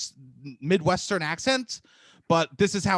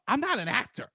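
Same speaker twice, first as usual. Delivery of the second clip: retold protest; 7 semitones higher, 3 dB quieter than usual. Retold protest, first take.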